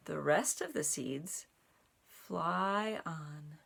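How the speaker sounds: measured storybook narration; reading to children. A narrator's reading. Reading the ocean page to children, steady storytime voice. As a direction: carry them gently over the ocean. Reads picture book aloud.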